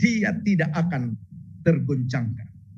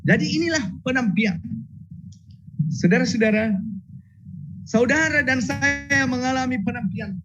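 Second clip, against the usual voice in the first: fervent preaching; 7 semitones higher than usual. Acted. Preaching at full force.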